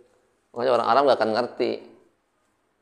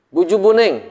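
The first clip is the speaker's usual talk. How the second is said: Betawi exclamation; 6 semitones higher, 4 dB louder than usual. Retold or imitated. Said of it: "misalkan ya".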